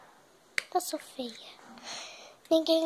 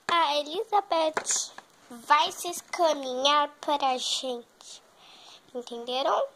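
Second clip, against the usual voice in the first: normal talking voice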